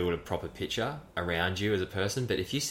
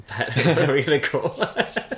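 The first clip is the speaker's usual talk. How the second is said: higher, louder, laughing through the words; giddy, raised voice with laughter; real laughing; 9 semitones higher, 5 dB louder than usual. Real, not acted. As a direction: say it while cracking up. Laughing over their business idea.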